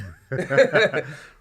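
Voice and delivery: big laugh, then back to talking; hearty laugh. Real, not acted. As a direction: let out a full belly laugh. Laughs at own point.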